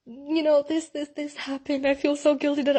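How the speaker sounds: high, choked voice recalling the guilt, a mock sob; imitating her crying self. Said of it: "I was bawling my eyes out".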